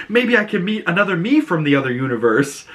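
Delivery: lightly voiced giddy hope; excited Vigilante. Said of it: "And he's all excited".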